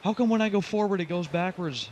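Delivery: high, loud, baffled protest; frustrated, starting high and easing down; exasperated complaint. Real, not acted. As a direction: complain loudly at a truck that won't obey. Truck slides back despite driving forward.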